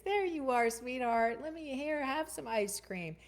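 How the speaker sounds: high, doting grandma to her grandkids; imitated grandma, pitched well up; sweet grandma. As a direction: lift it high, sugary grandma handing out treats. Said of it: "she's talking to her grandkids".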